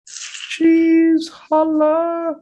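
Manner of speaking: high falsetto shout; squeaky high voice. Acted.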